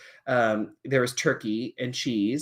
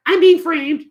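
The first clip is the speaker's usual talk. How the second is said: high, loud cat puppet protesting; 11 dB louder, slower than usual. Acted. Puppet cat insists to teacher she's innocent. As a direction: squeal it like an outraged cat puppet.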